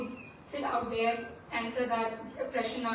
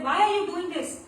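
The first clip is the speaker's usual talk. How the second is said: loud, high-pitched demand; yelling; 6 semitones higher, 5 dB louder than usual. Acted. Guru challenges whoever is out in the dark, yelling. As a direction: yell the questions at an unseen intruder. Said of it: "He started yelling".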